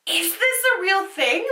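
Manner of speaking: high, disbelieving question; incredulous. Real, not acted. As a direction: ask it in real disbelief. Disturbed by the picture.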